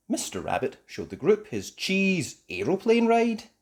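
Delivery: usual speaking voice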